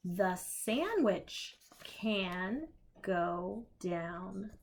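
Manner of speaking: slow picture-book reading; reading to children. Acted. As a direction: read it slowly to little ones.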